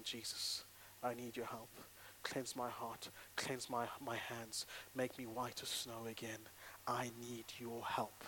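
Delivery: soft, low, humble prayer; quiet prayer. Shown as a demonstration. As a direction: pray it softly, almost breathing.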